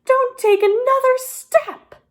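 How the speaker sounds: high, loud cry to stop him; calling out. A narrator's reading. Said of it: "Ollie called out".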